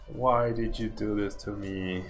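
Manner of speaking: ordinary delivery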